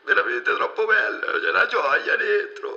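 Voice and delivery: overjoyed caller breaking down, wailing; wailing sob. Acted. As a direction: bawl out happiness through tears. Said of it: "ma stai piangendo?".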